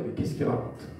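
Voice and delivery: whispered under the breath, mocking disbelief; scoffing whisper. Doubters whispering scornfully about the preacher, a whisper. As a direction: hiss it like a doubting onlooker.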